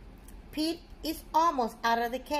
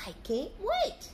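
eager; 5 semitones higher than usual. Acted. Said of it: "said Pete".

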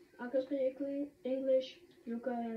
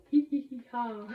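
loud, put-on hee-hee-ha cackle; imitated silly laugh; terrible fake laugh; 7 dB louder than usual. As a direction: laugh badly on purpose, syllable by syllable. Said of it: "That was the worst laugh".

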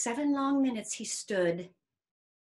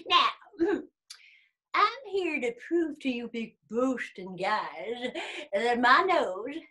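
put-on cocky old worm; 2 semitones higher, 5 dB louder than usual. Old worm challenging bear and rabbit, cocky. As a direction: put the big boasters in their place.

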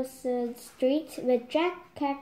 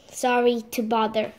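usual speaking voice

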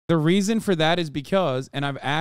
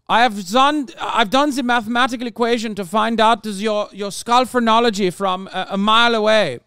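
louder, higher smug pseudo-scientist; 5 semitones higher, 5 dB louder than usual. Retold or imitated. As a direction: lightly play a pompous European know-it-all. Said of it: "European racism is like".

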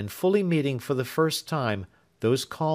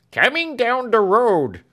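loud slow call, higher; 12 semitones higher, 8 dB louder than usual. A narrator's reading. Servant calls out that the coach crosses the bridge. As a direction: shout the news from afar. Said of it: "cried Britain".

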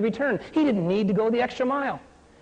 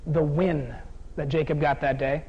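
usual speaking voice

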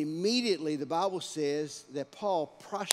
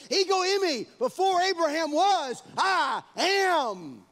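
loud, high, ringing proclamation; preaching at full force; 11 semitones higher, 9 dB louder than usual. Acted. Preacher proclaiming God's name to the church, at full force. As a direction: thunder the divine name out.

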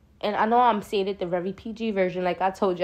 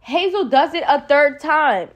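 incredulous outburst; 7 semitones higher, 8 dB louder, slower than usual. Real, not acted. Outraged at third stab gesture.

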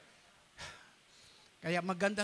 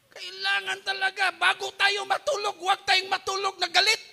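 urgent preaching; 8 semitones higher, 8 dB louder than usual. Real, not acted. Preacher raises voice urging.

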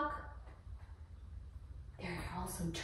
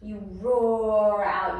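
slow, loud roaring "You roar out"; mother showing her child the lion breath roar; lion roar demo; 5 dB louder, much slower than usual. Shown as a demonstration. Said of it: "do you know how to do lion breath".

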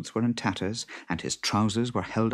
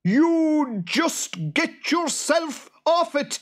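high, loud, slow creature yell; furious boggart screech; 6 dB louder, much slower than usual. A narrator's reading. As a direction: shriek the order, stretching each word. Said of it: "yelled the boggart".

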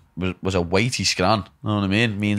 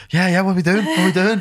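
high, eager hyped-up voice; excited American fan; 8 semitones higher than usual. Retold or imitated. Mimicking enthusiastic Yanks in a high excited voice. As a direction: play an over-the-top American fan. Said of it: "Just like".

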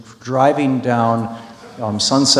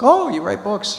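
high-pitched impressed exclamation; an intrigued "oh, really?" reaction; surprised; 9 semitones higher than usual. Retold or imitated. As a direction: quote her pleasant surprise, pitch jumping up. Rider reaction quoted in retelling.

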